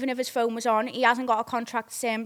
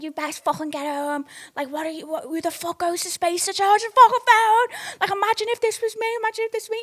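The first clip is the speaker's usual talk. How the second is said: retold screaming; 9 semitones higher than usual. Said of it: "fucking screaming".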